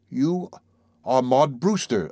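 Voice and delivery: slow, almost accusing statement; slow and certain. Acted. Narrator recognising Maud, slow and certain. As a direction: charge her with it like a crime. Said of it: "I said slowly and with certainty".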